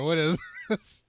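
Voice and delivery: ordinary talk, nothing marked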